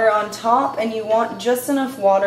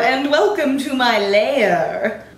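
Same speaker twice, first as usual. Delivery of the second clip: slow, echoing evil villain welcome; booming echoing villain monologue; 4 semitones higher, much slower than usual. Acted. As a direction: greet them like a movie villain in his lair. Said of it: "that was dumb, I won't do that again".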